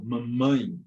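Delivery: "mamãe" with the ãe sent through the nose; nasal vowel. Shown as a demonstration. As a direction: hum the ending of "mamãe" nasally. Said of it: "para indicar um som nasal".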